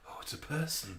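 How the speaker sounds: hushed, dazed voice of an apocalypse survivor; stunned whisper at a stranger. Retold or imitated. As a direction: whisper it like you've just crawled out of a ruin. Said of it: "everybody just comes out of a building and go".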